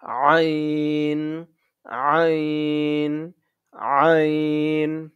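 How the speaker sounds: আইন squeezed from the throat, slowly drawn out; slow pharyngeal আইন with a long ending; throat-pressed letter. Shown as a demonstration. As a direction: press the throat to start আইন, then hold it. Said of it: "গলায় চাপ দিয়ে উচ্চারণটা করতে হবে".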